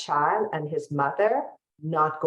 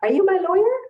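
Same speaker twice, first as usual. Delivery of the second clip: put-on much higher, bright kid asking his lawyer; imitating a ten-year-old greeting his lawyer; eager child voice; 6 dB louder than usual. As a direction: pitch way up like a small boy calling out. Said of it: "He's like".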